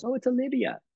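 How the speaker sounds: high, light voice for the dog owner; woman apologizing for her dog, high-pitched; apologetic woman. Retold or imitated. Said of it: "And she goes".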